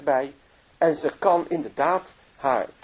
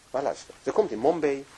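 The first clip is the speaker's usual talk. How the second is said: ordinary delivery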